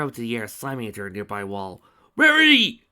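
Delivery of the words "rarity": higher, loud desperate scream of a name. A narrator's reading. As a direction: scream her name in panic. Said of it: "screamed Spike".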